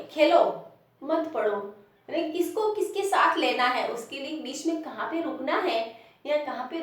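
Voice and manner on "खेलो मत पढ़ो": loud, high Khelo, then a break before मत पढ़ो; pause after Khelo. Shown as a demonstration. Teacher showing where one has to stop, pause after Khelo. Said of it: "जहाँ पे रुकना है".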